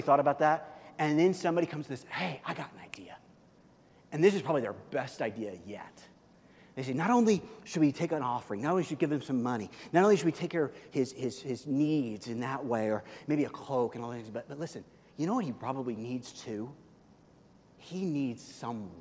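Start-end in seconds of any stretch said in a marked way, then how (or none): 2.1-3.2 whispered, unvoiced and soft. Church member whispering a new idea to the group. 6.9-18.9 building hushed idea. Retold or imitated. Church's best idea, getting softer.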